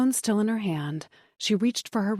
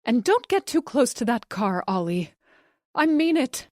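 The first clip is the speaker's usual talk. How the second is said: urgent warning; 5 semitones higher, 4 dB louder than usual. A narrator's reading. Wife warning husband near burning car.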